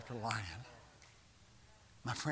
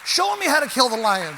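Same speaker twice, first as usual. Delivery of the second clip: loud, higher call to the crowd; preacher calling out to the congregation, loud and higher; rallying call; 10 semitones higher, 16 dB louder than usual. Acted. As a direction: shout it out to rouse them.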